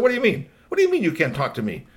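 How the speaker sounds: high, loud, indignant burst; hammed-up outrage at being snubbed; mad Billy Rob. Robot boss told nobody will talk to him, mad. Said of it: "Billy Rob got really mad".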